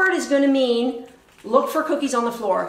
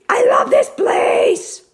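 slow, loud, higher put-on grouch voice; Oscar the Grouch; 8 semitones higher, 5 dB louder, slower than usual. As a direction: ham up a grumpy puppet, drawn out and loud. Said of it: "voice of Oscar the Grouch a little bit".

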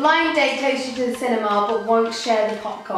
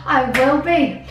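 put-on Cornish accent gone Irish; 5 semitones lower than usual. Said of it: "You've gone very Irish".

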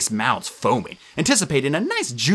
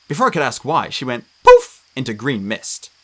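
ordinary delivery

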